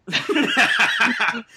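loud high laugh; a real laugh breaking out; burst of laughter. Real, not acted. Laughs after trailing off.

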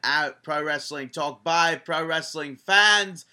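put-on slow, loud announcer intro that wavers between a British and an Australian accent; shifting British and Australian accent. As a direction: hype the show like a British announcer who keeps sliding into Australian. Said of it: "my accents kind of changed a little bit as I went from British to Australian".

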